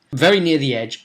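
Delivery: ordinary talk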